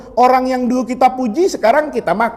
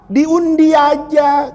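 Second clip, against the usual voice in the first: slow drawn-out decree; 4 semitones higher, much slower than usual. Retold or imitated. Wise captain decides.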